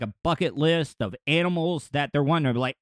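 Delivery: ordinary delivery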